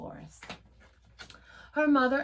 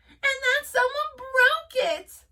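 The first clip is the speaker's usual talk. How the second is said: high, loud squeak of dismay; squeaky upset cub; 8 dB louder than usual. A narrator's reading. Baby Bear sees his broken chair, high and squeaky. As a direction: squeak out the bad news. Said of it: "squeaked Baby Bear".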